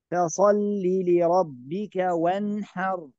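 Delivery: "وانحر" recited with no hum before the ح; measured recitation, short clean ن in "وانحر"; correct clear nun. Shown as a demonstration. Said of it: "لا نقول وانحر".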